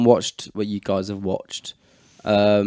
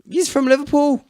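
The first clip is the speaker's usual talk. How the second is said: put-on pitched-up Liverpool accent sliding into Irish; Liverpool accent gone Irish; 12 semitones higher than usual. Friend explaining the granddad's roots in a failing Liverpool accent. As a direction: aim for Liverpool, come out Irish. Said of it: "That's going Irish again".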